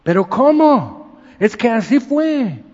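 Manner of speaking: high, loud and slow, acting incredulous; retold disbelief. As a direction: protest the accident you never saw. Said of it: "Y digo". (incredulidad imitada, aguda y fuerte)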